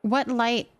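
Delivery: usual speaking voice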